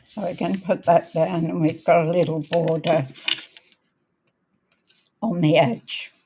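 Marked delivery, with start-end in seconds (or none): none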